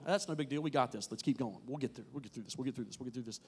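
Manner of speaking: soft, low, reassuring yourself; quiet self-reassurance. Retold or imitated. Preacher voicing a struggling believer, quietly reassuring himself. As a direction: murmur it to yourself as trouble mounts.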